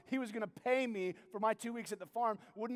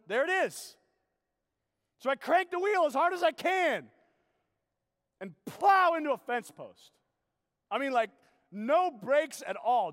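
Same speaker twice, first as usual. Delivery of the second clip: reliving the crash; 5 semitones higher, 7 dB louder than usual. Retold or imitated.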